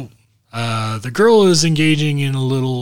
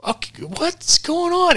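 high, drawn-out, baffled question; mock bafflement; slower than usual. Teller replaying his confusion at the ringing phone, baffled. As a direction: stretch it out high in exaggerated confusion. Baffled reaction in retold story.

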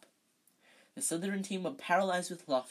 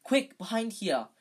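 usual speaking voice

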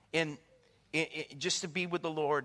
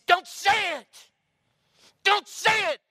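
high, loud, slow yell to stop; shouted angry refusal; furious shout; 14 semitones higher, 12 dB louder, slower than usual. Retold or imitated. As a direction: warn him off with fury. Angry stranger refusing the witness.

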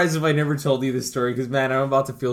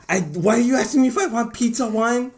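indignant aunt; 8 semitones higher, faster than usual. Retold or imitated. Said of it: "And he's like".

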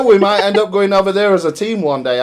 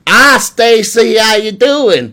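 slow, louder, higher broad Yorkshire-style English greeting; thick English accent; 6 semitones higher, 4 dB louder, much slower than usual. Shown as a demonstration. Greeting Stacy in a thick English accent to tease her. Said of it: "I was gonna try this for you".

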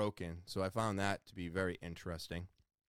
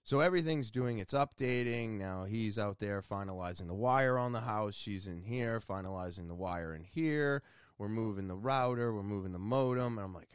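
normal talking voice

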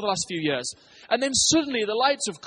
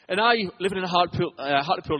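excited fast talk; faster than usual. Real, not acted. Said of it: "I'm so excited I'm talking so fast".

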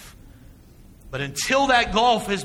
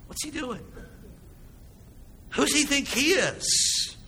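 higher, scornful muttered question; indignant scribe; 8 semitones higher, 3 dB quieter than usual. Acted. Scribe thinking to himself about Jesus, scornful. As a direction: sneer it like an offended scribe.

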